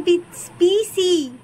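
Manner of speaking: slow পি- then পিসি with a clear s; modelling পিসি slowly for a toddler; careful pronunciation. Shown as a demonstration. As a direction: break পিসি apart, then say it whole and slow. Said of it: "ভালো করে উচ্চারণ করে".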